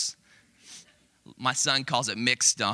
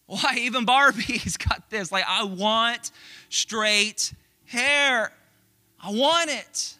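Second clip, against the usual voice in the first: put-on high, louder whiny child voice, dragged out; upset toddler; 9 semitones higher, 4 dB louder, much slower than usual. Toddler upset her Barbie has straight hair, high whining child. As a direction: whine like a frustrated two-year-old. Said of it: "She's like".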